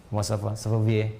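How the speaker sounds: lightly mimicked French speaker, pitched low; French accent imitation. Shown as a demonstration. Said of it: "Kayak orang Prancis".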